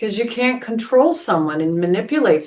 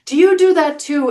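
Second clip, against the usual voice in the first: put-on delighted old man; 10 semitones higher than usual. Old man delighting in sake with the drunk, high and excited. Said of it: "And he goes".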